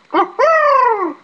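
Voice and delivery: a sudden, very high, squawking "Ha ha!" like a crow; crow caw. Retold or imitated. Joking with his coworkers at work, a very high crow-like caw. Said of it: "So they call me Crow".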